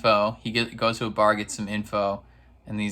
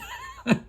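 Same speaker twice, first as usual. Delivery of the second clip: squeal laugh; 3 dB quieter than usual. Real, not acted. Laughs mid-quote.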